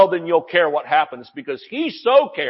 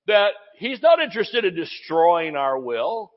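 ordinary delivery

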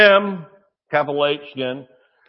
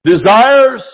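preaching shout; 8 dB louder than usual. Real, not acted.

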